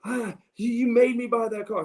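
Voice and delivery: high, exasperated dad imitation; frustrated dad. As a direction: accuse like a father who's been tricked. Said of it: "He is so frustrated".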